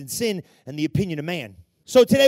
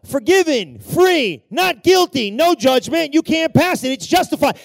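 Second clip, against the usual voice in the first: loud, high proclamation of a verdict; crying out; 6 semitones higher, 8 dB louder than usual. Acted. God's blood pleads for the stumbler, crying out loudly. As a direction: cry it out high, in forceful bursts. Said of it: "God's blood cries out".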